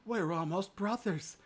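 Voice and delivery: high, drawn-out pleading; slow, high lament; begging harder. A narrator's reading. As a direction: beg plaintively as the wounded fox. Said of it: "The fox begged him even harder".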